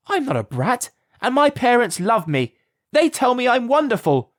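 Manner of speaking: put-on a hurt girl shouting back; screaming child. A narrator's reading. Alice shouts back at Gawk, high and loud. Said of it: "screamed Alice".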